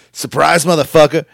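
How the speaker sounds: loud, high pounce on the words; mock ambush shout. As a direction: burst out like a surprise attacker in a retelling. Acting out a surprise locker attack.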